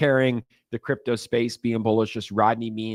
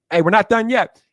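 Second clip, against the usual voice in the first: calling out; 9 semitones higher, 8 dB louder than usual. Real, not acted. Calling everyone back.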